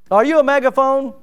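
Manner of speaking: normal talking voice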